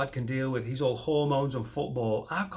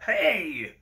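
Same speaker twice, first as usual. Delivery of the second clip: mock polite complaint; 6 semitones higher, slower than usual. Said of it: "you're meant to just be like".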